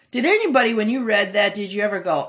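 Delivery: normal talking voice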